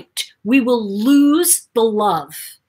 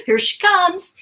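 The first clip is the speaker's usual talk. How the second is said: gasp, then higher voice; mock alarm; 10 semitones higher than usual. Class teasing the teacher, mock alarm. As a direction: play mock alarm as she arrives. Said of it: "everybody would go".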